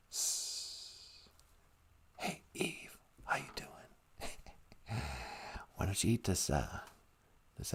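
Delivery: whispered snake hiss, soft and unvoiced. Snake hissing to lure Eve, whispered. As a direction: hiss her name like a sly snake.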